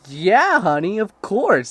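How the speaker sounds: loud, high, drawn-out agreement; mock-eager husband. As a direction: play up a husband's over-eager yes. Mocks husband eagerly agreeing with wife.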